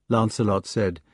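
plain reading voice

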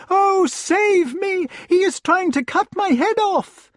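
very high, loud plea; screaming lady. A narrator's reading. Lady begs Lancelot to save her head, screaming. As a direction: plead frantically in a high woman's voice. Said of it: "screamed the lady".

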